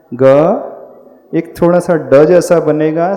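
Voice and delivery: ordinary talk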